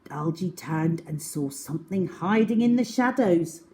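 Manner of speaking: usual speaking voice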